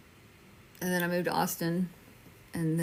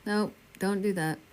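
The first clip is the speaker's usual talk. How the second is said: ordinary talk, nothing marked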